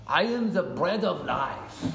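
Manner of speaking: put-on slow, drawn-out French accent; Jesus in French accent. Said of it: "try to do my best French accent".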